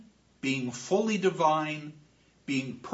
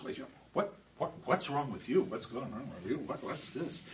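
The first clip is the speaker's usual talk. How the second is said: soft, puzzled questions, much softer; hushed bewilderment; 4 semitones lower, 10 dB quieter than usual. Acted. The chorus leader asks Orestes what is wrong, hushed and puzzled. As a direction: ask quietly, confused by his raving.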